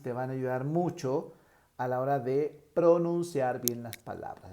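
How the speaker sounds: usual speaking voice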